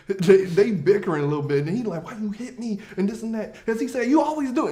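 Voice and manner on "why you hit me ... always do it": high whiny protest. Retold or imitated. Partner voiced, hit.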